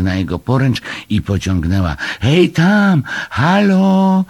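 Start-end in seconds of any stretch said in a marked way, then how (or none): 2.2-4.2 very high, loud hailing call; shout. A narrator's reading. Mother hailing castaways on the flood, high shout. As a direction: holler across the water. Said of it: "krzyknęła".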